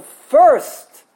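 "first" drawn out, loud; emphatic stress. Shown as a demonstration. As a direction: stretch and punch the word first. Teacher stresses the key word.

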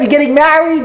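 high, gushing "you're getting married"; tactless gushing. Retold or imitated. Wedding guest to the bride, overexcited. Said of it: "Is that how you talk?".

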